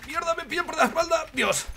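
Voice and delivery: very high panicked squeal; high, startled outburst; alarmed cry. Real, not acted. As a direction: cry out as you get caught from behind. Caught from behind in game.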